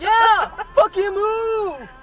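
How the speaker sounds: slow loud angry yell. Acted. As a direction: shout it again like you did then. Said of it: "She had the window down".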